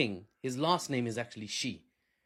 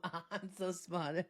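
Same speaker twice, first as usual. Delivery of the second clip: mock smug aside; 4 semitones higher, 8 dB quieter, much slower than usual. Mock Trump self-praise, laughing.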